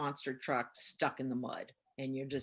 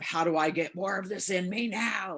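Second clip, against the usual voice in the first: manic craving; 2 semitones higher than usual. Retold or imitated. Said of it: "a manic, / compulsive".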